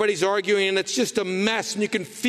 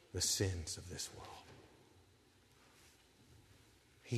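soft reflective; 8 semitones lower, 10 dB quieter than usual. Real, not acted. Sermon, soft intimate reflection.

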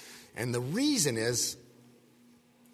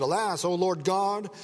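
mild alarm, higher pitch; alarmed cry; 7 semitones higher, 4 dB louder than usual. A narrator's reading. Gideon cries to the Lord God, mildly alarmed. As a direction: read it as a restrained cry of dismay.

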